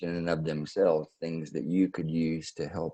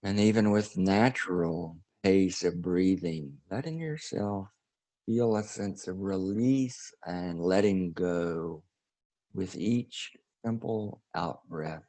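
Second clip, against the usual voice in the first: guided meditation; 2 semitones higher than usual. Acted. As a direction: ease the listeners into breathing and release.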